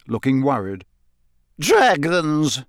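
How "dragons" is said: drawn-out word of disgust. A narrator's reading. Matron pulling Harry inside, disgusted. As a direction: spit it out with distaste. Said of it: "she said in a disgusted tone".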